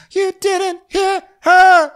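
high, loud, slow mock squeal; mocking Whitney. Podcaster mimicking drunk Whitney insisting Mary didn't hear, high mock squeal. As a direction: stretch it out shrill and insistent like a drunk friend.

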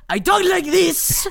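high, slow cartoon Russian accent. Retelling a voiceover audition in a hammy Russian accent. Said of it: "real Russian accent".

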